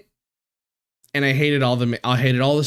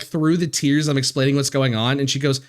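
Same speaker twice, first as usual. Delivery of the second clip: usual speaking voice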